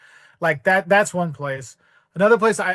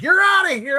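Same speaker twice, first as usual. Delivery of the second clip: higher, louder mock barmaid; stern waitress; 11 semitones higher, 6 dB louder than usual. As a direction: throw him out, mock stern. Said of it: "She's like".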